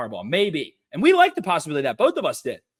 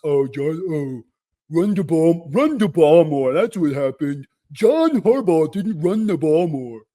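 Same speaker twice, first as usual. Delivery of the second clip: mock generic pundit; 2 semitones lower, 4 dB louder, slower than usual. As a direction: parody a clueless complainer, drawn out. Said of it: "speaking in generic terms".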